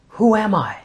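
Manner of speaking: high, searching question; wondering questioner. Acted. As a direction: ask it high, like a child wondering. Voices our question to God.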